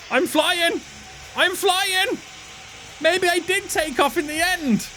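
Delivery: high, thrilled cry; excited. Real, not acted. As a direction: gasp it out in delight. Plane suddenly takes off.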